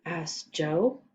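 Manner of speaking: plain reading voice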